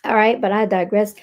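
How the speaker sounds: usual speaking voice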